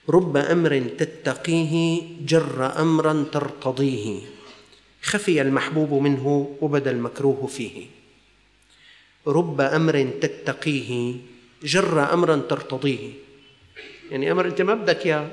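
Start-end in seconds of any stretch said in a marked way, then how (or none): 0.1-7.9 slow recitation. Shown as a demonstration. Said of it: "verse recited slowly". 9.3-13.1 slow recitation. Shown as a demonstration. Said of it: "verse repeated slower".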